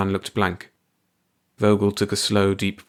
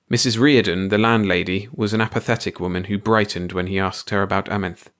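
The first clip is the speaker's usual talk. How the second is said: ordinary delivery, nothing marked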